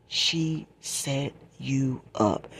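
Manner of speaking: "she set you up" very slowly, word by word; "she set you up" in slow, separate, weighty beats; retold emphatic warning. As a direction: space out "she set you up" to drive it home. Retells cousin driving the point home.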